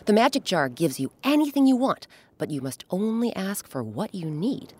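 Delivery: ordinary delivery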